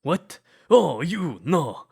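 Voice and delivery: sudden high, loud flustered denial; startled protest. Acted. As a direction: jolt up in panicked embarrassment. Shocked by cuddling misunderstanding.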